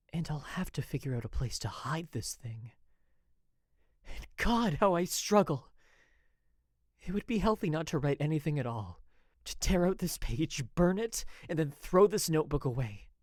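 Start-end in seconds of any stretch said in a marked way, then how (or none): 4.1-13.1 loud, high, desperate cry of struggle; anguished outburst. A narrator's reading. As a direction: let the anguish burst out loud.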